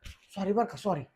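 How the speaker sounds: husband hushed after his wife says speak softly; hushed apology. Real, not acted. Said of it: "हळू बोला म्हणायला लागली Mrs".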